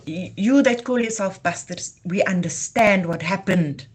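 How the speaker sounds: normal talking voice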